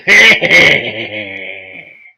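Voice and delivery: loud, long, high acted cackle; grinning menacing laugh. Minotaur grins after curse fails, loud acted laugh. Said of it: "And I start grinning".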